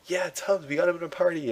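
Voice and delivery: high, excited cheer; excited teammate. Pretend. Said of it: "I'll be like".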